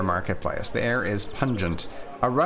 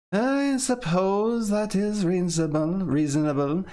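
reluctant concession; 7 semitones higher than usual. Acted. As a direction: give in unwillingly. Armand relents slowly.

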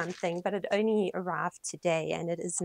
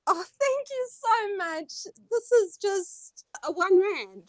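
overjoyed; 10 semitones higher, 4 dB louder, slower than usual. Real, not acted. Thanking friend emotionally.

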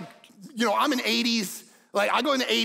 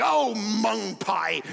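slow, drawn-out fake curse; a hint of a prim churchgoer cursing; much slower than usual.

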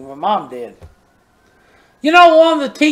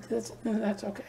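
softly reassuring mother, very quiet; gentle reassurance; 17 dB quieter than usual. Retold or imitated. Mother comforting her son over a C minus, soft. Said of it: "She said".

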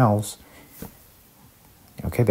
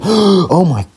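startled gasp; 10 semitones higher, 5 dB louder than usual. Real, not acted. Near death gasp.

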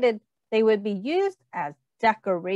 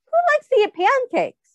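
high, bright asking voice; excited teacher question; eager question; 12 semitones higher, 5 dB louder than usual. Acted. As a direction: rouse the kids with a high call. Teacher asks children excitedly.

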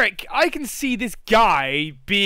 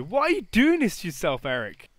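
ordinary talk, nothing marked

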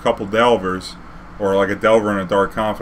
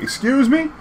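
much higher indignant rise. Retold or imitated. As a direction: excuse me with outraged disbelief. Indignant again.

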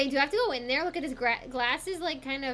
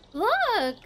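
much higher laugh of delight; 13 semitones higher than usual. Real, not acted. Gamer delighted by her cat's jump, laughing high. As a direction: burst out laughing at the cat.

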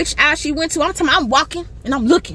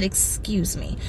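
"Excuse me" dropped much softer and lower; quiet polite excuse me; 6 semitones lower, 10 dB quieter, slower than usual. Shown as a demonstration. Showing the rude shopper what she should have said, quietly. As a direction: murmur it like the simple courtesy she skipped. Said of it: "you could have just said".

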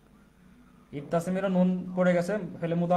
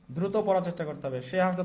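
ordinary talk

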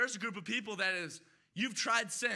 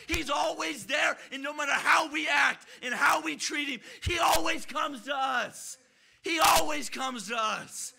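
loud, high, ringing declaration; full-force preaching; 6 semitones higher, 7 dB louder than usual. Real, not acted. Preacher proclaiming who our God is, full-force preaching. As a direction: belt out each phrase to the congregation.

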